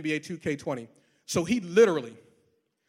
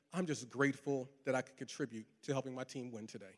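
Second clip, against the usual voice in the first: soft, much quieter humble thanks; 7 semitones lower, 12 dB quieter than usual. Retold or imitated. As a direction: give the credit away gently. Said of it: "simply said".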